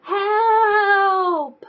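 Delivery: high, far-off cry for help; distant cry for help. Acted. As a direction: call out for help from far away. Character calls for help.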